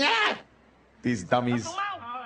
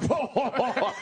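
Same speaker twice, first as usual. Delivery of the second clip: laughing whoa; 9 semitones higher than usual. Retold or imitated. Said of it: "goes like".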